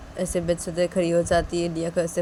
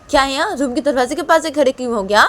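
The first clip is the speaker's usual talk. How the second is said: higher, louder, fast; angry demand; 8 semitones higher, 8 dB louder, faster than usual. Acted. Mother demands why Piyu stands at door, angry. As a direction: challenge why he stands there.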